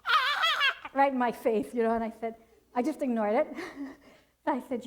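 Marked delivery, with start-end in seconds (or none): 0.1-0.9 high piercing imitated baby shriek; sudden high yelp of a cranky baby; baby's mock scream. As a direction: squeal it like a fussing infant right in your face. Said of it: "this baby the whole time's going".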